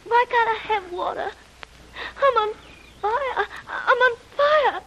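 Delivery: high, crying pleas for water; a feverish girl begging, near tears; delirious fevered pleading. Acted. Delirious girl begs Jim for water, high and crying. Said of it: "who is delirious with shock and fever".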